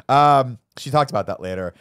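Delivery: ordinary talk, nothing marked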